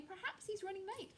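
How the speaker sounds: lightly voiced anxious bird; nervous hen. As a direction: wonder timidly. Nervous hen in dark den.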